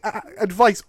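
normal talking voice

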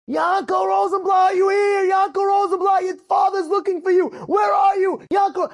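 high megaphone-style calling shout; screaming out. Retold or imitated. Friend searching Miron for Yanko with a megaphone, screaming out. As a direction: call out across a crowd for a lost boy. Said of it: "and he screams out".

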